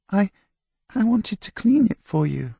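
very high, timid, halting explanation; small-voiced, hesitant excuse; meek little girl. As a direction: plead in a tiny high girl's voice. Scolded girl meekly explains herself.

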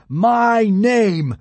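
loud, high, drawn-out boom; thunderous, stretched declamation. A narrator's reading. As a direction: proclaim it like a god. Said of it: "boomed Hiccup".

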